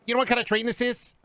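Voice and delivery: hammed-up dorky question. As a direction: put on a silly high voice. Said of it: "being like".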